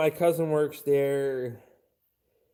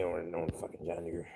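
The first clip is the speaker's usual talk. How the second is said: whisper; 10 semitones lower, 14 dB quieter than usual. Real, not acted. Muttered aside about John Deere.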